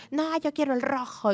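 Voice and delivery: a hint of a child digging in; insistent toddler. Toddler insisting on a choice to a parent, lightly voiced, stubborn. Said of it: "como que se aferran".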